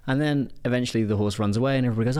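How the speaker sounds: ordinary delivery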